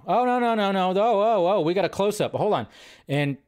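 usual speaking voice

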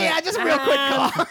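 high voice through laughter; laughing. Retold or imitated. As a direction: giggle through the excuse. Laughing while quoting himself.

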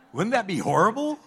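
laughing. Real, not acted. Laugh heard on line.

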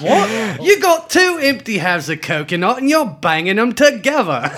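put-on high, indignant English accent, shouting the coconuts line; mock English outrage. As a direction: play an outraged English guard from Monty Python. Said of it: "good on the accent, mate".

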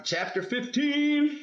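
high, drawn-out singsong heading; playful chapter announcement. Acted. Parent starting the next chapter for kids, playful announcing. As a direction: announce it grandly, stretching each word upward.

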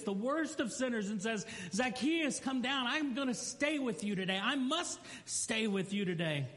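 high, ringing sermon delivery; preaching. Acted. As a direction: build it up from the pulpit. Fervent preaching passage.

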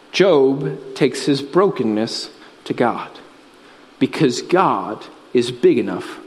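grave, unhurried pastoral assurance; solemn preaching. Real, not acted. Solemn low preaching passage.

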